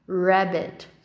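"Rabbit" with an English R at the start; correct English R. Shown as a demonstration. Teacher repeats the model for students, correct English R. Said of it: "Olha o som do R no começo da palavra".

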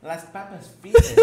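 high amused laughing question; laughing echo. Real, not acted. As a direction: repeat it through laughter.

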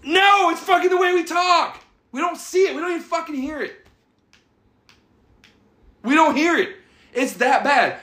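high-pitched, worked-up protest; indignant retort. Retold or imitated. Retells defending his own accent.

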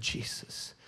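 slow, broken whisper of the name; faltering hushed prayer. Retold or imitated. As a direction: barely breathe the words out, stumbling. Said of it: "That was my prayer".

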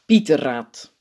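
ordinary delivery

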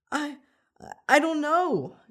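put-on high, halting kid answer; falter, then protest loudly and high; nervous honesty. A narrator's reading. Boy questioned by his teacher, nervous halting reply. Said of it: "Danny responded nervously and honestly".

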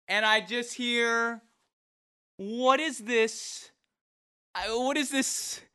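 high, stunned disbelief; can't believe what he's hearing; baffled. Real, not acted.

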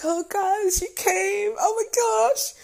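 very high, overcome squeal; acted-out happy tearful squealing; retold losing it. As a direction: gush in a squeaky, overwhelmed voice. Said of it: "I lost it all over again".

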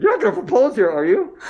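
wife's suspicious question. Retold or imitated. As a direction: imitate the girlfriend catching on. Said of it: "she goes".

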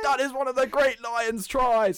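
high, loud, thrilled exclamation; excited shout. Real, not acted. Praising a famous Lions try to friends, loud and thrilled. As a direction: shout it like the try just happened.

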